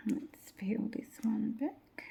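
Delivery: hushed aside; soft whisper while concentrating. Real, not acted. Whispering while peeling decal.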